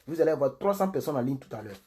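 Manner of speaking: ordinary talk, nothing marked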